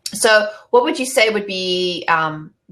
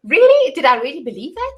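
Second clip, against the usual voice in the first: mock disbelief; 7 semitones higher than usual. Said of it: "It's like".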